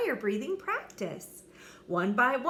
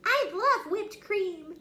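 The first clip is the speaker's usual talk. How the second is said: put-on much higher, slow little girl; delighted tiny sister; small sister dragon, happily; 11 semitones higher, 4 dB louder, slower than usual. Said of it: "said his little sister".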